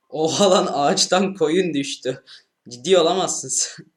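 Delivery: loud, high startled yelling; shocked shout. Real, not acted. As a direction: yell out in disbelief at the screen. Sheep falls from tree.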